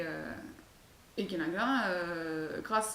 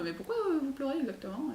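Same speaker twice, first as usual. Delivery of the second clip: put-on little girl asking; 6 semitones higher than usual. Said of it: "s'approche et lui demande".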